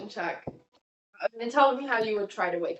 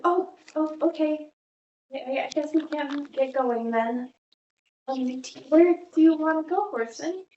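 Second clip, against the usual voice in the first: put-on higher, hesitant young woman's voice; flustered Samira; 5 semitones higher than usual. Game master as Samira answers Horson, higher and flustered. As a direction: voice her caught off guard, eager to please. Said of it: "She's like".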